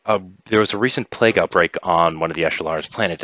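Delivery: normal talking voice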